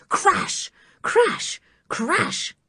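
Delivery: high punchy crash sounds. A narrator's reading. Narrator to the listener, punchy crash sounds. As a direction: smash each word out. Glass smashing.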